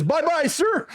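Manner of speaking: high, mock-eager military sign-off; imitating a naive, chipper salute; imitated recruit's farewell. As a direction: send it up high like an overeager recruit. Recruit salutes departing officer, all laugh.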